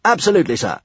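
high, prompt soldier's reply; eager military yes-sir; military promptness. A narrator's reading. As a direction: answer like a cadet at attention. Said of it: "answered with military promptness".

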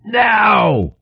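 high, drawn-out cry of command; shouted command. A narrator's reading. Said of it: "Frawl shouted".

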